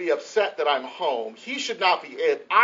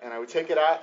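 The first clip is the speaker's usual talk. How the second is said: ordinary talk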